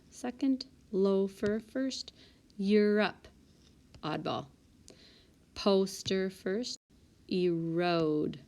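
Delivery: ordinary talk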